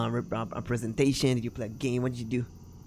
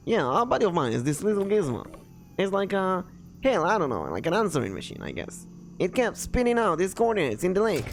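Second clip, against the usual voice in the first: raised, loose character voice; casual local explaining; 6 semitones higher than usual. Acted. Local describing his buddy's gizmo, casual. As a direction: explain it offhand, groping for words.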